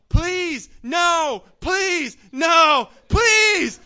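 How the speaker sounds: high, loud, desperate pleas; imitated screaming. Preacher voicing the boy in the barber chair, screaming. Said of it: "he screamed and he yelled".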